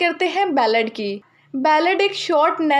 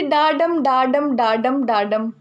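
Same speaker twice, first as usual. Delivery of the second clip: डाडम with the weight on the second beat, da-DUM; iambic da-DUM demo; 3 semitones lower, slower than usual. Shown as a demonstration. Teacher demonstrating iambic stress to students, rhythmic da-DUM. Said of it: "second syllable पर stress दिया जाता है".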